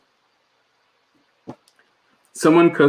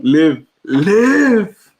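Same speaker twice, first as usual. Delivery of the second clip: mock-dramatic command to live, second one drawn out and higher; mock plea; 5 semitones higher than usual. Host answers a friend's "I'm dying", mock-dramatic plea. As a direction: beg them to live, hammed up.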